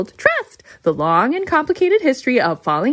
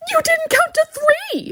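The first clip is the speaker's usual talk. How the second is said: shrieked much higher in shock; shocked protest. Acted. Host to Ima after she falls, shrill shocked protest. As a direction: yelp it out, stunned she fell.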